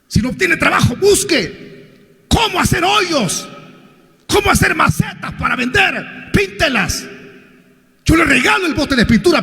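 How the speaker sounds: rally the flock, building to a roar; preaching at full force. Acted. Pastor exhorts congregation at full force.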